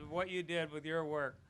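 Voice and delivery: usual speaking voice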